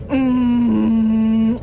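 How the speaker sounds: long, loud droning hum; sustained engine-like yoga breath; calming drone on one note. Acted. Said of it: "it's my Ujjayi breathing".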